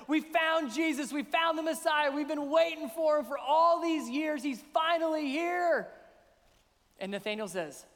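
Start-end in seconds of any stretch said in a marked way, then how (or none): 0.1-5.9 higher and louder, bursting with joy; overjoyed disciple sharing the news; excited. Acted. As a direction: announce the news like you can't contain it. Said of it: "all excited".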